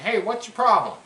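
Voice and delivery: hey, call the dog out, half joking; loud playful scold at the whining dog. Real, not acted.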